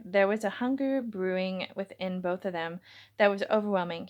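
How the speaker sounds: ordinary delivery, nothing marked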